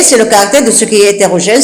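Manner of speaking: ordinary talk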